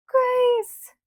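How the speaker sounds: very high, loud, long cry of a name; shrill call. A narrator's reading. Ma calls over the slough grass, shrill. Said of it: "shrill call".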